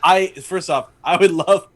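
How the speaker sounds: ordinary delivery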